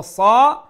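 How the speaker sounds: সা high, loud and held; one alif long. Shown as a demonstration. Teacher demonstrating length, long loud সা. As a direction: sustain a full-mouth সা. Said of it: "এক আলিফ লম্বা".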